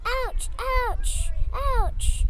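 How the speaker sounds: high-pitched, voiced little ouches; high repeated yelps, not truly whispered; pained mock whisper. A narrator's reading. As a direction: squeak out each ouch in pain. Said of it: "whispered Puck".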